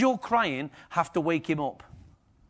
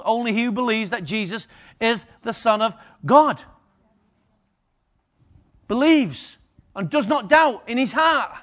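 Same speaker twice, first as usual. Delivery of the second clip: loud ringing scripture proclamation, higher and louder; preaching at full force; 6 semitones higher, 6 dB louder than usual. Acted. Preacher proclaiming scripture to congregation, full force. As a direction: declare it to the whole church like a trumpet call.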